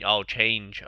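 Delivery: ordinary talk, nothing marked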